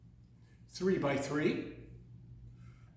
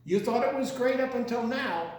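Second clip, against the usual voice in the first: mock excited; 6 semitones higher, 4 dB louder, faster than usual. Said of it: "now gonna get red hot exciting".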